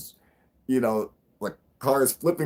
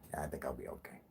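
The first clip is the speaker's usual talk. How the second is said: 1 whisper; 9 semitones lower, 19 dB quieter, much faster than usual. Real, not acted. Hushed aside.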